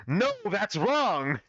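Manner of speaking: high, drawn-out mock shout; slow, hammed-up protest pitched high; dramatic objection. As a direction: cry it out like a debate objection. Acted objection in a debate script.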